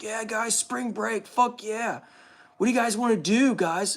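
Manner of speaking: high, excited dopey dad voice; Randy impression. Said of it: "and Randy's like".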